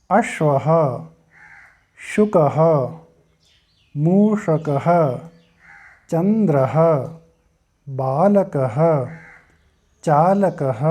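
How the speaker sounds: each word alone, with a pause after it and a clear breathy ह sound at the ः; careful pronunciation drill. Shown as a demonstration. Teacher reciting Sanskrit nouns for the class to repeat, careful pronunciation. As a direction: recite छात्रः, गजः, घटः one at a time, voicing every visarga. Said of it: "तो आइए हम उच्चारण कर लेते हैं".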